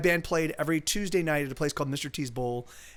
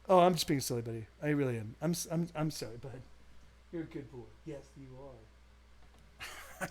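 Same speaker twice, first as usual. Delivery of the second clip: tender dog talk; 3 semitones lower, 8 dB quieter than usual. Real, not acted. Owner quietly soothing his big dog, soft and tender. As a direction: coo it quietly to a pet.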